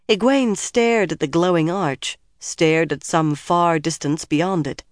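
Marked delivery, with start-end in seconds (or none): none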